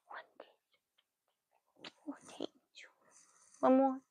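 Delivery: soft whispered thanks for a kiss. Real, not acted. Mom whispering to her baby as she asks for kisses.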